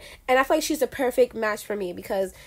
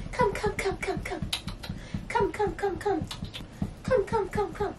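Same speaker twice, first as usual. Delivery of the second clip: high, soft coaxing call; calling a puppy; 7 semitones higher, 10 dB quieter than usual. Real, not acted. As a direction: coax the shy puppy to come over.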